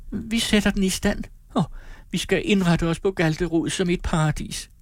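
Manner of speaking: put-on very high, rapturous young woman; a girl swept away by her dream; dreamy girl. Amalie drømmer om Galterud.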